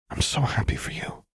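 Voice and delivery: hushed, heartfelt congratulation; tender joy. A narrator's reading. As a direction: breathe it out, moved for a friend. Friend tenderly shares her joy.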